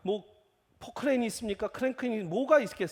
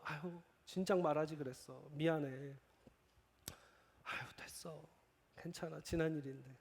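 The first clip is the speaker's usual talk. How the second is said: soft apology; 4 semitones lower, 10 dB quieter than usual. Retold or imitated. Soft reconciling reply.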